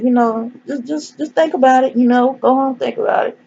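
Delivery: high-pitched coaxing imitation; mock coaxing. Boss coaxes him to consider.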